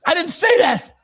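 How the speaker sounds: very high, loud protest; mock whiny denial. Mocking a co-host's angry denial, high and loud. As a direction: whine it like he's been wronged. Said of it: "He goes".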